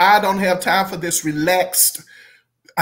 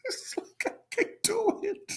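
high, very soft, voice nearly gone from laughing; helpless laughter; 7 dB quieter than usual. Real, not acted. Reviewer unable to imitate the singer, helpless laughter. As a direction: squeeze the words out with no air left. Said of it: "I'm glad I'm laughing about it".

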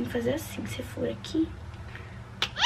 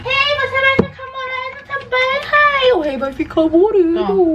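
very high, slow, drawn-out cooing; pet-talk voice; 11 semitones higher, slower than usual. Real, not acted. Vlogger cooing to Rei about becoming dark-haired, high pet-talk voice. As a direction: stretch the words in a high singsong.

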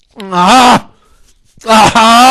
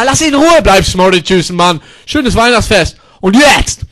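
ordinary delivery

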